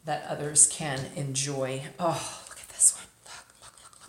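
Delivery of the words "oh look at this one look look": breathless hushed delight; excited whisper. Real, not acted. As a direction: whisper it in awe. Excited over a new scarf.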